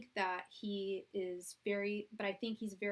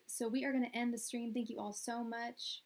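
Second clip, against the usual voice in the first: usual speaking voice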